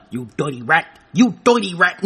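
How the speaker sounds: put-on old film gangster voice; mock gangster. Gangster confronts a rat in Chicago, mock gangster voice. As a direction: play a Chicago gangster sneering a threat.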